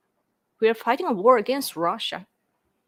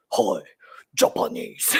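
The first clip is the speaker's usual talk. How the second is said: much lower, gruff mock greeting; deep mock Japanese man; 11 semitones lower than usual. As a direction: imitate a comedian's deep macho Japanese man. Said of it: "mocking Japanese people".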